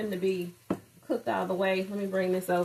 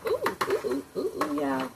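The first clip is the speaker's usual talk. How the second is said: high delighted cooing over the food; excited; 6 semitones higher than usual. Real, not acted. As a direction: squeal with real delight at the taste. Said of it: "I'm tearing up".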